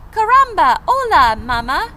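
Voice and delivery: put-on high, loud squeaky baby chick with Spanish flair. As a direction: greet mama as a cheeky newborn chick, high and loud. Newborn chick greets Jack.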